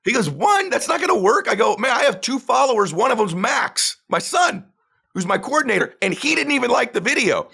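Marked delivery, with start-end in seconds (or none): 0.3-0.7 ONE shot up very high as a question; incredulous echo. Retold or imitated. Coach hears only one video was made, incredulous. Coach echoes the answer in disbelief. 0.7-1.5 high-pitched, dismissive retort; mock exasperation. Coach tells beginner one video won't do, exasperated. 1.8-7.5 high-pitched mock indignation; comic complaint. Beginner tells coach even his son skipped liking it, indignant.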